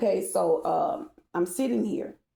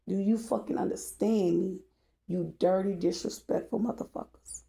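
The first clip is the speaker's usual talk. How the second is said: usual speaking voice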